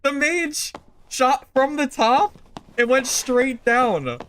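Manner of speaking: high, loud and slow, savouring it; excited replay. Real, not acted. Streamer recapping the mage's fall to chat, thrilled and drawn out. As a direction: relive the shot with glee.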